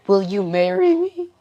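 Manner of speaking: loud, high hammed-up tearful plea; mock crying. Sensei crying over a gift of sushi, mock-tearful. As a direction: propose through put-on tears. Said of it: "I start to cry and say".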